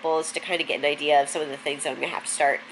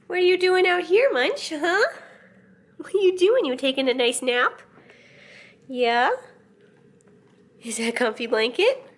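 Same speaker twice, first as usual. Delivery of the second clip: high, sing-song cooing at the cat; 9 semitones higher than usual. Real, not acted. Cooing baby talk to her cat Munch, high and sing-song.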